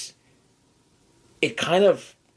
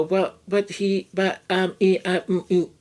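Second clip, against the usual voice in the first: hesitant excuse-maker; 5 semitones higher than usual. Retold or imitated. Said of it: "people kind of go".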